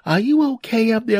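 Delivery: high mock wife voice; imitated wife calling.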